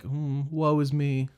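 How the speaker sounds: slow, drawn-out mock moan; mock self-pity. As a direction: imitate a wimpy sufferer, slowly. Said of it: "she just seemed really wimpy".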